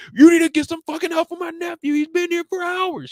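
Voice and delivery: high, loud furious uncle at the front desk; mock angry demand. Uncle demanding help for his nephew, loud high shout. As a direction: yell it up high at the clerk.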